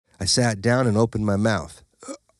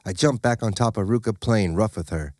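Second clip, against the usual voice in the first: ordinary delivery, nothing marked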